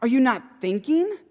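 high, loud indignant protest at Jesus; indignant priests. Retold or imitated. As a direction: play the outraged priests demanding an answer. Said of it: "they go".

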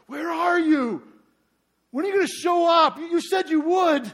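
high, loud desperate shout at God; anguished cry. Retold or imitated. David crying out to God, loud desperate shout. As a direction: plead like a man abandoned. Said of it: "He's crying out to God".

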